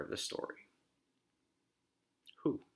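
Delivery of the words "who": usual speaking voice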